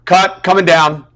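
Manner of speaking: loud, high, slow callout; director calling cut. Retold or imitated. Director halting a failed take, calling out. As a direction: imitate a shout across the set.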